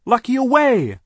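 very high, loud yell; shouted counter-order. A narrator's reading. Sister overrules the foreman, shouting high.

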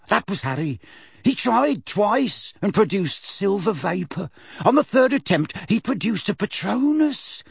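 high, eager witness account; gaining courage as she tells it. Acted. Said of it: "her voice stronger and more confident now".

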